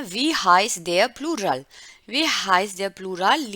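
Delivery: Wie heißt der Plural read very slowly and loud. Shown as a demonstration. Teacher reads the exercise prompt aloud to the class. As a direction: read the question out slowly, each word given weight. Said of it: "reads task aloud".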